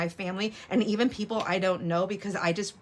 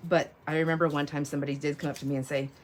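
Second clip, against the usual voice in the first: usual speaking voice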